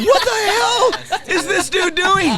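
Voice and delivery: very high, loud mock outrage; astonished shout. Onlookers watching him chase his headlight, astonished shouting. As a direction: yell it as a stunned bystander.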